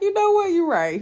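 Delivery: loud, high realization, then dropping; retold agreement. Wife replaying her answer to husband, high emphatic agreement. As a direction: act out suddenly agreeing, pitch jumping up. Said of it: "And I'm like".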